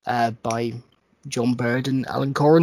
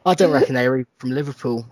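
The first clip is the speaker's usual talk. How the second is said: usual speaking voice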